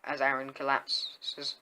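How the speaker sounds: ordinary delivery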